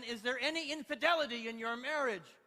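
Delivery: hammed-up courtroom exasperation; exasperated judge. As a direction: press it loudly as an exasperated judge. Judge pressing again, losing patience.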